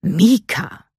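sharp, stern call of the name; stern warning. A narrator's reading. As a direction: pull her granddaughter up short. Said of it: "mahnte ihre Oma streng".

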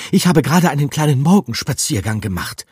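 high, loud and fast rat voice; breathless rat. A narrator's reading. Out-of-breath rat starting her tale, fast and high. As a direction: rush out the words still panting.

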